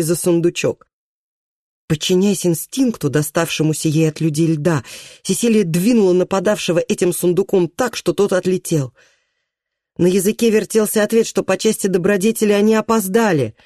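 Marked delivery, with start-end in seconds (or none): none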